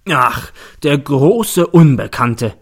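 slow, loud sneering scoff; mocking. A narrator's reading. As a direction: drag out the mockery. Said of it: "spöttelt der Staatsanwalt".

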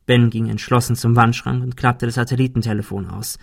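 ordinary delivery

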